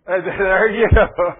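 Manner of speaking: a big loud laugh breaking into the words. Real, not acted. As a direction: laugh hard at yourself, then push the line out. Laughs at his own story.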